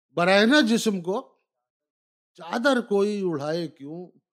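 rising, declaimed couplet; poetry recitation. Acted. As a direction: declaim it to a live audience. Recites couplets, each repeated.

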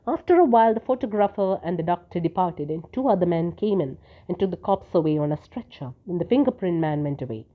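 ordinary delivery, nothing marked

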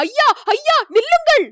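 very high, urgent calling out; alarmed shout. A narrator's reading. Companion calls the hunters to stop before the boars, alarmed shout. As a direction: shout desperately to halt the riders. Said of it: "Kandamaran கூச்சலிட்டான்".

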